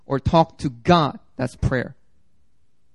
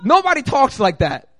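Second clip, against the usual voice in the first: higher, louder acted comeback; indignant retort; 7 semitones higher, 4 dB louder than usual. Preacher replaying his reply to teasers, indignant. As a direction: push back indignantly, pitch raised. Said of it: "And I'd be like".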